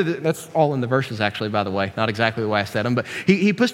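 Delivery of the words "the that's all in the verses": ordinary talk, nothing marked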